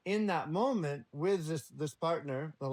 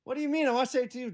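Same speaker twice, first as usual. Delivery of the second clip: protesting partner; 8 semitones higher, 6 dB louder, much faster than usual. Retold or imitated. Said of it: "upset partner objecting".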